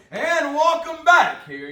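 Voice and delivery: booming PA announcer welcome; big arena announcer voice; stadium announcer. Pretend. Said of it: "You're supposed to do your P A voice".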